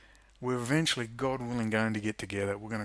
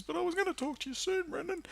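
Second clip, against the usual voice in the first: much higher whiny mimicry of an excuse; mocking Max Egan. Mimicking Max Egan's excuse to Brendan, high and whiny. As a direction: squeak it out like a lame excuse. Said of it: "Now he says".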